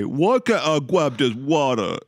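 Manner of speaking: put-on slow, drawn-out Boston accent. Teasing a friend with a slow Boston accent. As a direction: drawl it like a Bostonian. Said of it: "that's your average Boston accent coming back again".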